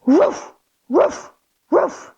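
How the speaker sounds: barking like a puppy; three playful little barks; mock puppy woofs; dog barks. Shown as a demonstration. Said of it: "three big barks".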